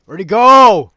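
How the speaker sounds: high, loud yell of Where'd he go; shouting at a vanished opponent; frustrated shout. Real, not acted. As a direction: bellow in real frustration at the lost enemy. Losing the enemy, repeats.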